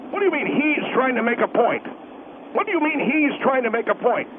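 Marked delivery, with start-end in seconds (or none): none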